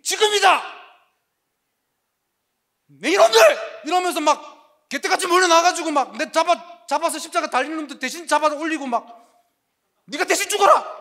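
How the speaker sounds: rally the troops with a shout; battle shout. Pretend. Imagined Jesus fighting back. (크게 외치며 반격하는 예수님 상상)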